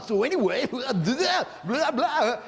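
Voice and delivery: high, manic giggling babble; wacky goofball. Retold or imitated. Dude overdoing his act for the girls, wacky and high. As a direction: imitate a man clowning too hard. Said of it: "keeps doing this, like, wacky shit".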